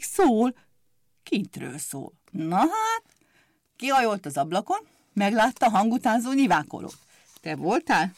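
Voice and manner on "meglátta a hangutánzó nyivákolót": ordinary delivery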